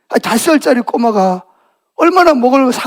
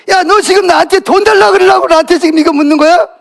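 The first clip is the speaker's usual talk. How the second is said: high-pitched irritated outburst; annoyed; 7 semitones higher, 4 dB louder than usual. Acted. Father snapping at son who asks for money, annoyed. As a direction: scold the kid in exasperation. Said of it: "아빠가 막 짜증이 나요".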